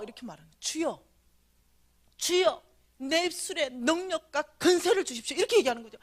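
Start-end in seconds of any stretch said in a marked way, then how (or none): 0.6-5.3 fervent prayer. Retold or imitated. Prayer spoken in dream.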